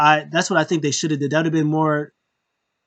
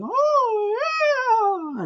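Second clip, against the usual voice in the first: a very high, stretched-out oh yeah; imitated wrestler cry. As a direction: belt out the entrance cry like a hyped fan. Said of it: "Undertaker's entrance cry imitated".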